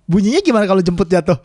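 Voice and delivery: ordinary talk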